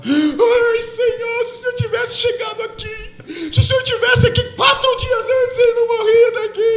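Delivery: high, wailing cry of grief; desperate crying plea. Acted. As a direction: beg him through tears, voice high. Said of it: "Ela sai correndo, desesperada".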